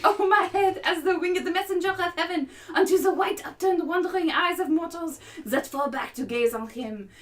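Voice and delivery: hammed-up lovestruck passion; impassioned Romeo. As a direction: cry it out, overcome with longing.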